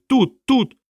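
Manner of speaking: very high creature squeal; sudden forceful cry. A narrator's reading. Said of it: "із несподіваною силою".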